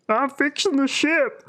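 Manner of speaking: very high, slow mock holler; mock yelled film line. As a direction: imitate calling out from afar, stretched and high. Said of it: "like, yelling down to him".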